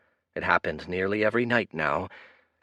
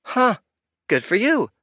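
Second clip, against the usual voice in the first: put-on unimpressed girl; 12 semitones higher, 6 dB louder than usual. Girl shrugs off the priest.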